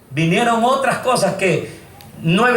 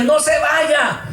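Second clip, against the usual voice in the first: pleading; 8 semitones higher, much slower than usual. Retold or imitated. Begging the voice to stay.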